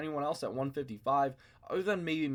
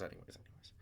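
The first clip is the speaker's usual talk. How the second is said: whisper; 19 dB quieter, faster than usual. Real, not acted. Trailing off to move on.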